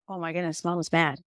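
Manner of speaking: put-on a scared child murmuring under his breath; hushed kid's aside. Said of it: "the other kid was like".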